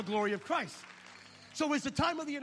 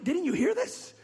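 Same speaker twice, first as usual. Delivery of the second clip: exasperated; 7 semitones higher, 9 dB louder, slower than usual. Retold or imitated. Arguing with wife.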